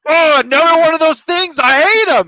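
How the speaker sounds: loud mocking teen voice; mock sulky teen. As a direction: sneer like a bored nineties teen.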